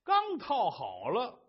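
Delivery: ordinary delivery, nothing marked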